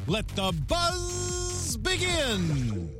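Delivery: normal talking voice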